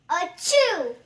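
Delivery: loud fake sneeze; big sneeze. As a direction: blast out a giant achoo.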